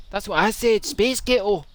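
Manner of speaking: hammed-up Scottish indignation; indignant Scot. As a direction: insist in put-on Scots. Scottish character retorts to American.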